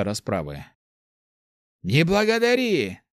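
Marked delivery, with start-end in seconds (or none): 1.8-3.0 higher, smug mage. A narrator's reading. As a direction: brush off thanks, pleased with himself. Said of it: "проговорил маг".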